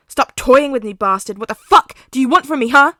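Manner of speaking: loud, fast angry shout; furious yelling. Acted. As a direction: scream it in his face. Katsuki yelling.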